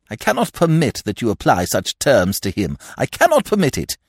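insist, hitting cannot; emphatic. A narrator's reading. Godfather forbidding André's words, emphatic. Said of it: "was very emphatic".

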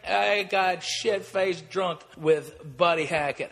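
ordinary talk, nothing marked

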